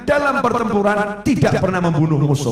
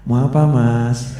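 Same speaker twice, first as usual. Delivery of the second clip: slow, meek, hesitant question; timid; 7 semitones lower, 3 dB louder, slower than usual. Shown as a demonstration. Wrong way for a leader facing an enemy, meek and slow. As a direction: ask weakly like someone afraid. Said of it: "Ya ora pantes loh".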